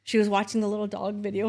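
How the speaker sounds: normal talking voice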